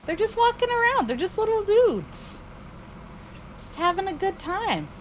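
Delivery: put-on high, cooing baby talk; cutesy high voice. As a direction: coo over the little critters. Cooing over little animals.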